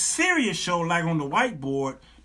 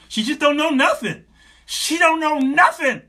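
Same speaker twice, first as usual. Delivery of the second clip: high, loud, hammed-up complaint; exasperated boyfriend; 9 semitones higher, 6 dB louder than usual. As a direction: complain to your counselor, voice pushed up. Voices exasperated man complaining about girlfriend.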